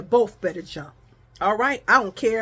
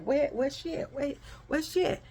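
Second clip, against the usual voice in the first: hushed, higher-pitched searching murmur; soft, raised-pitch mimicry of people looking around; mimicked onlookers; 4 semitones higher, 8 dB quieter than usual. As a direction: imitate nosy onlookers under your breath. Mimics onlookers turning to look.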